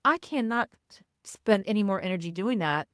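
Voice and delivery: normal talking voice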